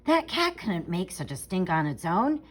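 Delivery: usual speaking voice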